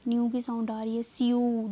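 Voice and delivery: normal talking voice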